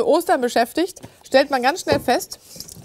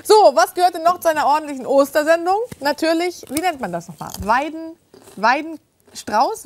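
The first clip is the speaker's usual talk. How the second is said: ordinary delivery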